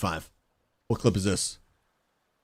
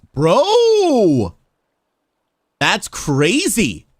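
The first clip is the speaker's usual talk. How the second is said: excited; 8 semitones higher, 6 dB louder, much slower than usual. Real, not acted. Reacting to his own clip.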